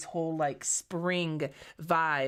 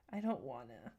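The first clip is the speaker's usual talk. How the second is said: mopey, low droning whine; Eeyore voice; 4 semitones lower, 11 dB quieter than usual. Real, not acted. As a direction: sulk it out like Eeyore. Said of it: "Don't be Eeyore at me".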